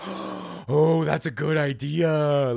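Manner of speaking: gasp, then drawn-out impressed reaction; slow, wowed imitation of her approval; mock amazement. Acting out lady's delighted reaction.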